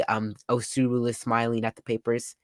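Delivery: ordinary talk, nothing marked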